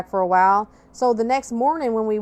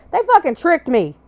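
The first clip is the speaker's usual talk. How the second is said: louder, higher, still stung by it; loud indignant complaint; indignant outburst; 7 semitones higher, 5 dB louder than usual. Real, not acted. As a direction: spit it out, betrayed. Retelling being tricked by cops.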